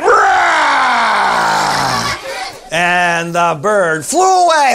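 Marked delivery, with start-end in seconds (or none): 0.0-2.1 loud mock roar; loud growl. Girl growling at a bird, loud mock roar. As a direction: growl to scare it off. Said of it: "But Sarah went".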